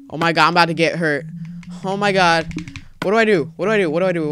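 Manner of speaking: loud, rushed panic; frantic and loud, repeating in alarm; panicking. Real, not acted. Player about to get hurt.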